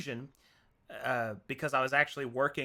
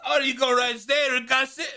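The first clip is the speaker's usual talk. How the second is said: imitating Aussie guy; 9 semitones higher, 5 dB louder than usual.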